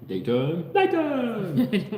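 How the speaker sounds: high silly chicken voice calling daytime; a loud, high cartoon-chicken call. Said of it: "was it the chicken?".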